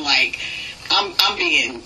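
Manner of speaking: ordinary delivery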